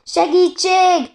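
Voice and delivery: high, loud, drawn-out scream for help; slow, piercing distress call; shouted cry for help. A narrator's reading. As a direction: yell desperately for rescue. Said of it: "kiabálta".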